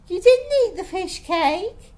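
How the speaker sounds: high, loud accusation; accusing. A narrator's reading. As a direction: contradict her sharply and loudly. Friend accuses her in quarrel.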